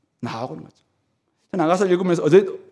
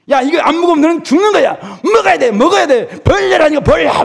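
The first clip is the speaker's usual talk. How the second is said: much higher, loud threatening yell; mock angry yelling; 10 semitones higher, 6 dB louder than usual. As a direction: scream orders at a child who won't eat. Forceful feeder parodied. (강제로 먹이는 사람처럼 고함치기)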